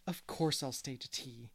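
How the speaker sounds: ordinary delivery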